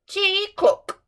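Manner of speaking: high, loud clunky chirp; clockwork bird. Acted. The golden toy bird singing badly for the Emperor, clunky chirp. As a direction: chirp like a broken wind-up toy.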